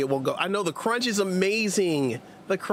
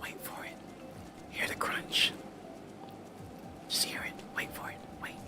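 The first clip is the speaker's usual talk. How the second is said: soft unvoiced ASMR whisper; 5 semitones lower, 12 dB quieter than usual. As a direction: whisper close to the mic. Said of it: "Redeem A S M R".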